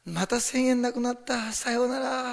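high, drawn-out mock lament; grudging farewell. Giver parting with a thousand yen, grudging lament. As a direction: sing out a slow, regretful goodbye. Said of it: "惜しみながらする人なんです".

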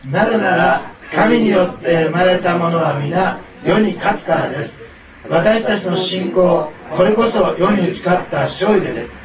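loud, slow, energetic group recitation; rally everyone to recite in a big voice; big-voiced unison reading. Real, not acted. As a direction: declaim the verse loudly, phrase by phrase. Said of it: "大きな声で言ってあげてください".

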